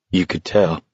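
subdued, faintly trembling voice; understated quaver. A narrator's reading. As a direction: let it tremble slightly, held low. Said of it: "in a trembling voice".

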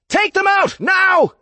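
loud, high shouted attack order; bellowed order. A narrator's reading. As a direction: bellow the command at your troops. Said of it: "the Inquisitor on the ground bellowed".

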